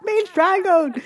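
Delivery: high, slow hammed-up plea; mock cry for help. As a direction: squeal it like a fake victim. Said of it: "It's like".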